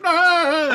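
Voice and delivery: sudden high, loud mock scream of pain. Imitating the hero in the hand test, mock screaming. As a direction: belt out a short cartoonish wail. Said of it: "He's like".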